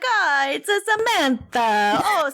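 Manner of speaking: playful, over-the-top Italian impersonation; mock Italian accent. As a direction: ham up a silly Italian accent. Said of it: "just have an Italian accent".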